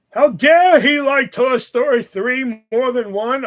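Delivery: high, loud, slow mock indignation; hammed-up scandalized gasp of a line; mock outrage. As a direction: ham up the shock, slowly. Mocking outrage at David.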